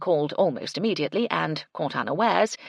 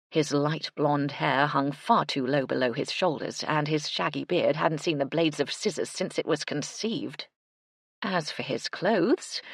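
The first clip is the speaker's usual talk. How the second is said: usual speaking voice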